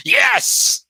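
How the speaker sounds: manic high-pitched yell; shouting. Acted. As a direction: scream yes like a madman. Father wagers family fortune, shouting.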